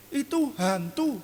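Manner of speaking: high, slow terrified shout of a ghost; frightened cry. Retold or imitated. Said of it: "mereka berteriak dengan ucapan apa".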